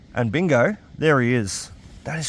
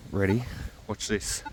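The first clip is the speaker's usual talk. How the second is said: sneaky quiet; 4 semitones lower, 10 dB quieter than usual. Real, not acted. Sneaking up to throw ball.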